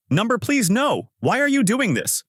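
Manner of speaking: very high-pitched distressed plea; acted panic, pitch far up; alarmed protest. A narrator's reading. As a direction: push them away with a high, pleading voice. Pushing away a teacher's hug.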